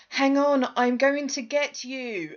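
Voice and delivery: high, hammed-up rescue cry, slow at the end; mock heroic call. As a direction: parody a heroine's stiff reassurance. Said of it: "And she's like".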